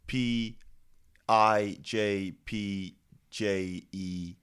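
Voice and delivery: slow, letter by letter spelling. Shown as a demonstration. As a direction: spell it out slowly.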